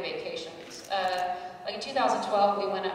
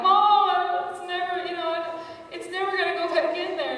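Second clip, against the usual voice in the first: much higher, louder, whiny sung wail; mock crying wail; 10 semitones higher, 8 dB louder than usual. Calling her mom at night, wailing in mock tears. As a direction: wail to mom like a sobbing teen. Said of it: "and I'm crying".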